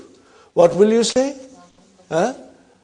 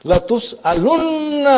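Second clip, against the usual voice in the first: لَتُسْأَلُنَّ rung out high and fast; emphatic stress; 9 semitones higher, 3 dB louder, much faster than usual. Shown as a demonstration. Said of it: "What is the last line of it".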